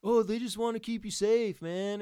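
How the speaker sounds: mock them in a silly high voice; mocking naive voice. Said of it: "who are like".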